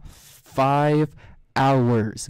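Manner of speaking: drawn-out emphatic "Five hours"; stress on five hours. Real, not acted. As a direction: hammer "Five" then "hours" apart. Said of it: "they are five fucking hours".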